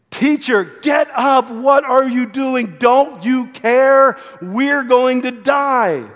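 frightened shout to wake the sleeping teacher; panicked disciples. Retold or imitated. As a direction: shake him awake in panic. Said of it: "They're shaking him".

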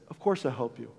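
low, soft friend's voice; acted calm, hushed promise; quiet reassurance. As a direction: drop down low and gentle to reassure. Friend calmly agrees to help.

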